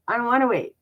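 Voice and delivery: a hint of a spoiled child refusing; spoiled brat. Telling God she won't wait, bratty whine. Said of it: "like a spoiled little brat".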